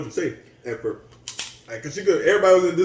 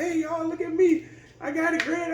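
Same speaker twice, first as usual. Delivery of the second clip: much higher, carefree show-off voice; showing-off nephew. Retold or imitated. Retelling the nephew bragging while driving, high carefree call. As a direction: play the nephew hamming it up on the mower. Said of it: "he going and he like".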